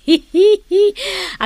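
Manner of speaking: high, bright giggle of delight; a pleased, flattered laugh. Acted. Ansing flattered by the compliment on her looks, high giggle.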